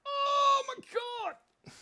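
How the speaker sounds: high, slow, shouted Oh my God; mock shock. Streamer reacting to own drawing, hammed-up shock.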